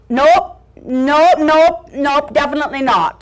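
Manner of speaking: loud, repeated brisk refusals; gruff Scotty refusal. Acted. Scotty with package refuses puppy, emphatic dog voice. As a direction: rap out each no as a stubborn dog.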